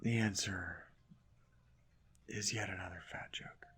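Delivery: tired whispered grumble; weary whisper. Real, not acted. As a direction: whisper it, worn out by the game. Whispered complaint.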